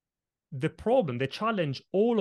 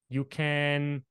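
normal talking voice

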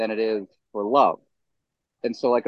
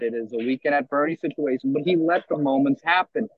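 ordinary delivery

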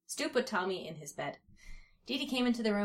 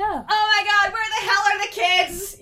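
high, very loud panicked-mother yell; loud high-pitched outburst; 13 semitones higher, 13 dB louder than usual. A narrator's reading. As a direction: yell it at full volume, far above your narration. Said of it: "yelled Dee Dee".

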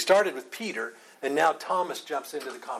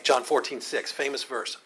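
ordinary talk

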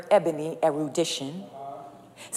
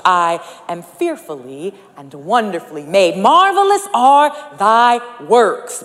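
rising preaching; 2 semitones higher, 10 dB louder than usual. Acted. Preacher proclaims the psalm to the congregation, building loud. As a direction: lean hard into "Marvelous are thy works".

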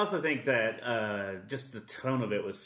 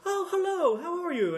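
very high mock-sweet hello; playful greeting; 3 dB louder than usual. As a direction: imitate a coy hello, sailing way up in pitch. Acts Audrey's playful greeting.

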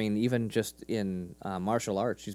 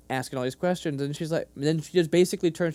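ordinary delivery

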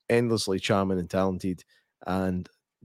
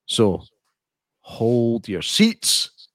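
dramatic slow; 4 dB louder, much slower than usual. Acted. Dramatic announcement.

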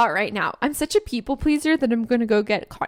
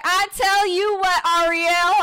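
high, drawn-out country accent impression; slow, high-pitched country drawl; tipsy country twang; 6 semitones higher, slower than usual. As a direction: imitate a tipsy friend's Southern twang. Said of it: "when she's had a couple drinks, she's like".

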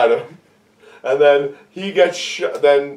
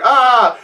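mock yell; 8 semitones higher, 4 dB louder than usual. Gunman yelling before kick.

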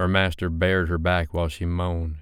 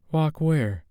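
Sophie, wary; 10 semitones higher than usual. Acted.

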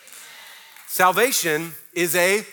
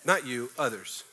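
ordinary talk, nothing marked

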